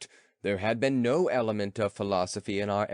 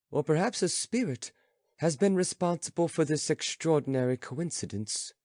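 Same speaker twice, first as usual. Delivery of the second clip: put-on lightly raised, earnest young companion; companion's voice; 7 semitones higher than usual. Companion answering the philosopher, lightly voiced. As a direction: speak up politely but firmly.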